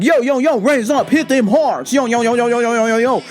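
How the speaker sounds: rhythmic, repetitive hype-man chant; punchy mock rap patter; mock wannabe rapper. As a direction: imitate an amateur rapper hyping himself up. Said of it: "a rap concert at my work".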